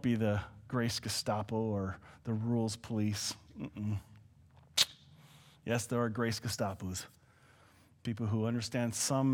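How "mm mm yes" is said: usual speaking voice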